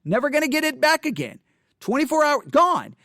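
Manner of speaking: ordinary talk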